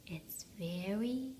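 hushed, rising to hopeful; soothe, then brighten; soft bedtime. A narrator's reading. Bedtime hush, rising end.

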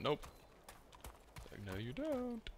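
high, squeaky taunting voice for the game; mocking game voice. Player mocking the game for blocking his rush, squeaky taunt. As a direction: voice the game as a smug little taunt. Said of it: "the game's like".

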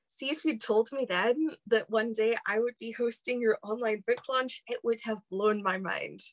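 ordinary talk

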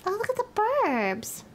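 light put-on wonder; high delighted voice. Streamer voicing the sim admiring the suburbs, high delighted.

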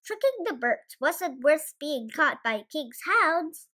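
usual speaking voice

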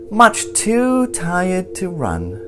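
plain reading voice

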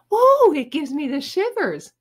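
high, squeamish gushing; hammed-up girly squeal; fawning girl. A narrator's reading. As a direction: shudder girlishly in a high voice. Judy fawning over the sting.

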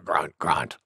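low, soft grunted words; wild boar grunts. Acted. Wild boar grunting after sneeze.

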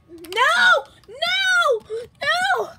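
high, wailing shouts of no; drawn-out high-pitched protest; distressed cry. Pretend. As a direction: cry out in mock despair. Cries out as glasses are thrown.

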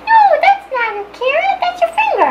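very high, squeaky protesting voice; brain's voice. Acted. Brain stopping someone biting their finger, squeaky and panicked. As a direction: squeal it like a tiny alarmed brain. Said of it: "that's what your brain sounds like".

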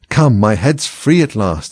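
plain reading voice

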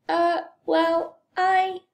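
high, hesitant stalling; stall, caught out; sheepish, drawn-out hesitation; stammering. A narrator's reading. Boy stalls when told to explain.

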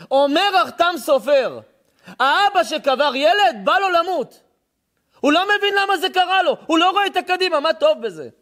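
preaching at full force. Acted. Preacher crying out the Chatam Sofer's lesson to the listeners. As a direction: hammer home the lesson.

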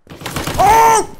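high, loud "Oh!"; real startled outburst; startled scream. Real, not acted. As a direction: yelp in shock at the game. Startled by the game.

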